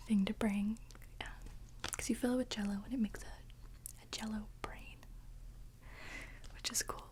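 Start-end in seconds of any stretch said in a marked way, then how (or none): none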